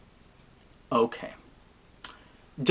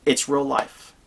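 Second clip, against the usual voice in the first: normal talking voice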